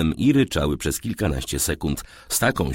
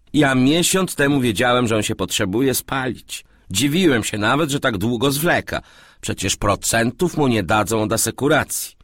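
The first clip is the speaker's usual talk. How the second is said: higher, slightly put-on dialogue voice; lightly voiced knowing businessman; 7 semitones higher, 4 dB louder than usual. A narrator's reading. Man tells his flatmate he foresaw the fire, lightly voiced knowing tone. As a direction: explain it as one who knew already, lightly voiced.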